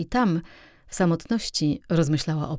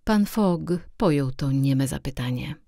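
ordinary delivery, nothing marked

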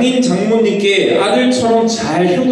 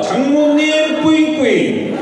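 high, cutesy aegyo voice; cutesy baby talk; 8 semitones higher, slower than usual. Pretend. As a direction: coo it like a cute child. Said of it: "큰 소리로 장모님께 애교 한번 발사합니다".

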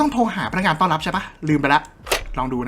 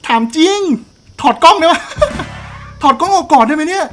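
panicked pleading; 10 semitones higher than usual. Real, not acted. Panicked at a scary game moment.

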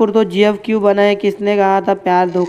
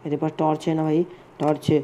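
much softer, rushed muttered aside; low quick aside to himself about the torch; quiet aside; 5 semitones lower, 9 dB quieter, faster than usual. Real, not acted. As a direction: say it under your breath, fast. Mutters aside while playing.

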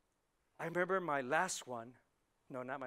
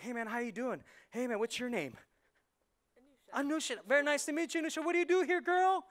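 rising, louder, eager hello to a stranger; excited greeting; 9 semitones higher, 8 dB louder than usual. Retold or imitated. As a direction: greet her like an overexcited newcomer.

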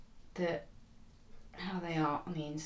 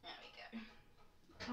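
barely voiced, slow whisper; 19 dB quieter, much slower than usual. Real, not acted. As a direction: breathe it out under your breath. Quiet aside while putting tray in.

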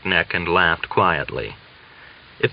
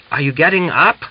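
impatient, higher and louder question; irritable; 8 semitones higher, 5 dB louder than usual. A narrator's reading. Mitka at her window, impatient: irritable question. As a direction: push her, annoyed at the wait. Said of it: "he said irritably".

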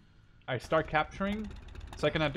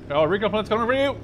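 loud, higher urgent call; louder, higher shout over the game; calling out a warning; 7 semitones higher, 9 dB louder than usual. Real, not acted. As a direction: warn your buddy fast. Warning teammate of plane.

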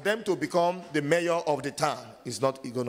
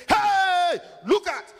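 much higher, louder alarmed call; shout; 7 dB louder, slower than usual. Retold or imitated. Bystander alerting the wounded man, a loud alarmed shout. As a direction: shout a warning across the street. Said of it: "somebody just say".

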